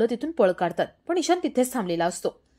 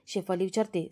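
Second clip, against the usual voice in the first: usual speaking voice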